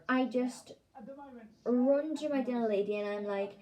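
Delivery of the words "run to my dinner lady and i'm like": ordinary delivery, nothing marked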